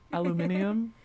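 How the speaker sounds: "aluminium" with five syllables, al-u-MIN-i-um; British "aluminium". Pretend. Hosts trading British words, British pronunciation. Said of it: "That's enough of bad British accents".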